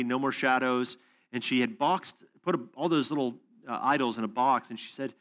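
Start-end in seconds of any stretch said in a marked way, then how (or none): none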